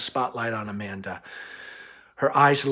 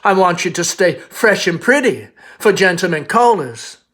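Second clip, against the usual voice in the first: higher, louder, doting Southern belle mother; fussing Southern mother, higher and louder; 7 semitones higher, 10 dB louder than usual. Acted. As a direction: coax her daughter sweetly. Amanda voiced over narration.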